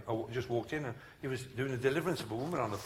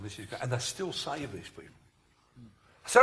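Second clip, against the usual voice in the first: usual speaking voice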